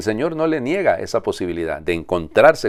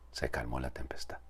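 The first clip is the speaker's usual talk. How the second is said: whisper; 5 semitones lower, 16 dB quieter than usual. Acted. Whispered calm after storm.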